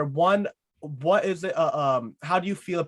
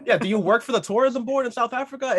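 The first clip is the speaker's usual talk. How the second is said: laughing, higher, playful jab; laughing tease; 8 semitones higher than usual. Real, not acted. Host laughing while teasing the guest about tourism. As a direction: giggle through a teasing accusation.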